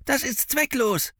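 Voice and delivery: high-pitched urgent objection; protesting boy. A narrator's reading. Finn warns the bounty hunter, high urgent protest. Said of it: "protestierte Finn".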